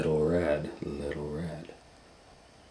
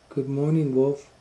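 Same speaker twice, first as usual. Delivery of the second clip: put-on much higher, loud polite little girl greeting; 9 semitones higher, 7 dB louder than usual. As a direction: greet him sweetly and politely. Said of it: "answered politely".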